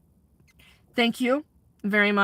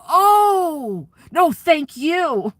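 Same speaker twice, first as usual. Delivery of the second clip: higher, louder, slow drawn-out laughing impression; imitating Robert, laughing; 6 semitones higher, 8 dB louder, much slower than usual. Caretaker retelling Robert's belated thanks, laughing slow high impression. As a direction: stretch it out through the laughter, playing the sheepish man.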